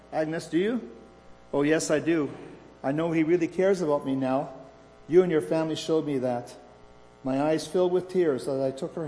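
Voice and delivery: expressive read-aloud storytelling; story narration. A narrator's reading. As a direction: read the story out with lively dialogue.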